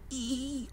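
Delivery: high, soft deer squeal; a small high-pitched animal noise; imitated deer cry. Streamer guessing what deer sound like, soft high squeal. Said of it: "how do deer sound".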